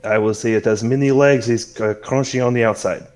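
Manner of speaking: put-on accommodating host with a French accent; courteous French-flavored hostess. Celeste answering Yafai's request for bugs, gracious French-accented hostess. Game master plays Celeste.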